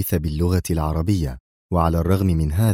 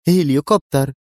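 higher-pitched, edgy one-word answer; nervy retort; 7 semitones higher, 4 dB louder than usual. A narrator's reading. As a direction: answer with nervous irritation. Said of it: "أجابت في عصبية". (بعصبية)

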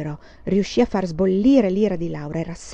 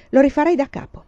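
defiant; 6 semitones higher, 7 dB louder than usual. A narrator's reading. Defiant sister.

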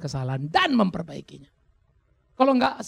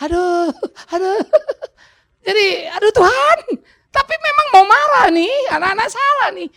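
mock exasperated outburst; 10 dB louder than usual. Pastor reenacting his anger at the children, exasperated strained outburst. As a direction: wail it like a father about to explode.

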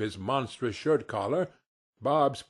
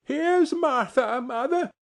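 put-on very high, slow, excited child voices; high-pitched gleeful child's cry; children crying out; 13 semitones higher, 4 dB louder than usual. A narrator's reading. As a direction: shout the news like two small children. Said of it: "cried the two young Cratchits".

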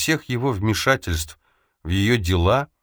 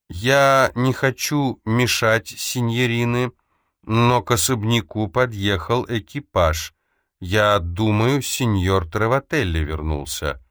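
higher, slow and measured delivery; Kaza's polite announcing voice; 4 semitones higher than usual. Acted. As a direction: announce it politely in a raised-pitch, unhurried character voice. Kaza's voice speaks in room. (высокий, размеренный, вежливый голос Казы)

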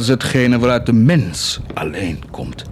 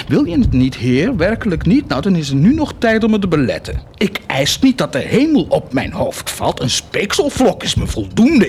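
taunting God; 9 semitones higher than usual. Acted.

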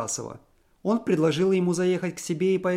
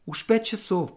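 inner thought; 7 semitones higher than usual. A narrator's reading. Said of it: "подумала она".

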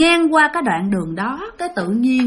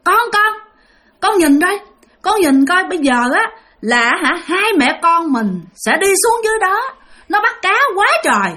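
put-on higher-pitched eerie mother talking to her child; ghost mother's voice; 6 semitones higher than usual. Ghost mother pointing out the fisherman to her child, high and eerie. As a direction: point out the prey to your child, voice raised high. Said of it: "mà bả nói với lại con của bả".

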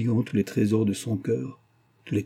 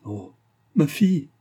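cri désespéré; 5 semitones higher than usual. A narrator's reading. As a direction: cry out for your daughter in anguish. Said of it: "s'écria Vertua". (cri aigu et fort de désespoir)

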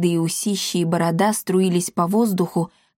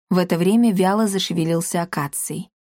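ordinary delivery, nothing marked

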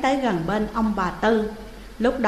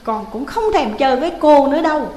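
put-on high, fast, loud sulky child; cranky child; 5 semitones higher, 5 dB louder, faster than usual. Young Thẩm grumpily threatening ghost Hiền, high fast and cross. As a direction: snap back like an annoyed kid. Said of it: "Thẩm phát quạo".

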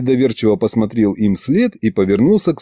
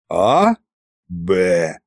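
questioning А, then a dropped Б; mocking mimicry; 4 semitones lower than usual. A narrator's reading. Tsar mimicking the husband's question, mocking. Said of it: "передразнил царь-батюшка".

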